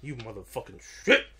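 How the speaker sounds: loud, raging hammed-up threat; mock furious threat. Friend retelling a game heroine's rage, a loud mock threat. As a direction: scream it as an enraged fighter. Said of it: "She's like".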